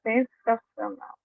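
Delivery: "sensacional" said slowly, soft and flat; meh, saying you're great without meaning it; flat half-hearted. Shown as a demonstration. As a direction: let "sensacional" drag, soft and unconvinced. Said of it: "Eu uso essa palavra quando eu tô meio assim".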